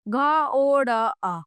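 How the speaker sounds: घ ओ ड़ अ slowly, each sound separate; sounds split apart. Shown as a demonstration. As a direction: break घोड़ा into its letters slowly. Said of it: "जैसे घोड़ा". (ध्वनियाँ अलग-अलग धीरे बोलकर)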